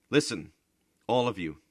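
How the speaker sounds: loud call for attention. A narrator's reading. Bodini calling his family to listen, loud. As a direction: raise the voice to gather them.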